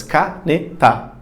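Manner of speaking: "Caneta" slowly in three beats: ca, ne, ta; separado por sílabas. Shown as a demonstration. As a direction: count out the syllables of "Caneta" with pauses. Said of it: "tem três sílabas". ("Caneta" devagar, sílaba por sílaba)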